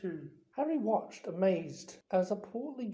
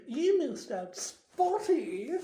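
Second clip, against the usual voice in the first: high sly teasing, a touch louder; hissed taunt; 8 semitones higher, 4 dB louder, slower than usual. Acted. Peeves taunting Myrtle in her ear, sly hiss. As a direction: jab her with the nickname. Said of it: "Peeves hissed in her ear".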